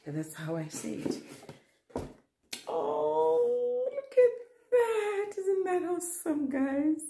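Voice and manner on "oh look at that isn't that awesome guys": high, loud delighted squeal; excited squeal. Real, not acted. Unboxing new sneakers for viewers, squealing with delight. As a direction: squeal with joy at the gift. Thrilled unboxing Nike shoes.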